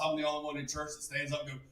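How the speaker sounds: ordinary talk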